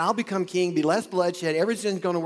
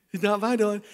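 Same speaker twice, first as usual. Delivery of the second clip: scoffing, a little louder; mocking retort; 6 semitones higher, 4 dB louder than usual. The men answering David, a scoffing retort. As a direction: brush it off with a laugh.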